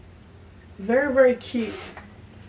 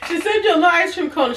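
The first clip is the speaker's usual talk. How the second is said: laughing; 7 semitones higher, 4 dB louder, faster than usual. Real, not acted. Laughing at her slip.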